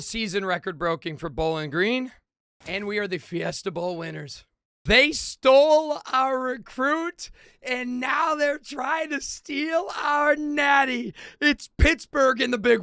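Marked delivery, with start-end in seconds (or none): 4.9-12.8 mock outrage. Real, not acted. Outrage at rival.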